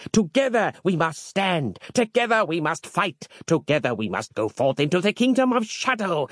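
rally the brethren to holy war; rousing monk sermon. A narrator's reading.

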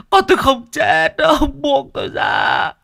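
father screaming denial while held back; crying shout. Acted. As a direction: scream "không chết" through tears and struggle free. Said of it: "Ông nấc lên từng tiếng".

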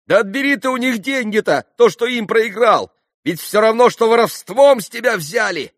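very high, loud urging; urgent exhortation. A narrator's reading. Landlord urges Mitya to reclaim money, loudly insisting. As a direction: press him loudly to take it back.

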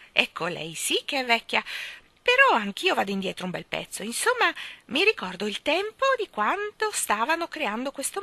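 high, loud crone voice bragging; old Mrs Corey boasting. Acted. Old woman boasts to children of her great age. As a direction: brag gleefully like a spry old crone.

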